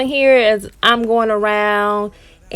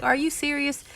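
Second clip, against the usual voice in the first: higher-pitched incredulous question; lightly acted surprise, pitched up; retold disbelief; 6 semitones higher, 6 dB quieter than usual. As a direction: check in mock shock that she means it. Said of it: "I was really shocked".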